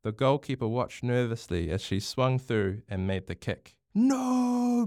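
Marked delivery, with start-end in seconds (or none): 3.9-4.9 high, drawn-out wail of protest; desperate roar. A narrator's reading. Bronx fails to stop the kick, roaring. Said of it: "Bronx roared".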